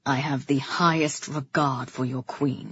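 low, level reply; restrained brother. A narrator's reading. Brother assuring the king of his regard, restrained. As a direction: answer low and controlled.

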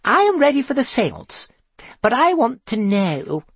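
high-pitched announcement; Gloria Troutbean. A narrator's reading. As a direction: announce it in an unmistakably high voice, a little nervous. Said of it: "the unmistakably high voice of Gloria Troutbean".

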